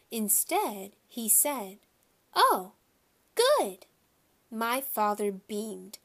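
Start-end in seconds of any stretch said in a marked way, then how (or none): 2.4-3.9 put-on high, loud toddler exclamation; pleased toddler voice, pitched up; little boy's delight. A narrator's reading. Toddler's gleeful approval in story.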